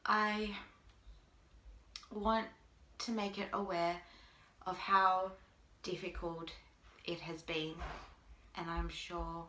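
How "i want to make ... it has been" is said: ordinary talk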